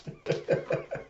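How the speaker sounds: short, very high tooting notes; imitated music. Someone playing Alita's harmonica, imitated music. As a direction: mimic a harmonica with quiet squeaky toots. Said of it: "you hear someone playing some music".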